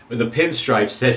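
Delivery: usual speaking voice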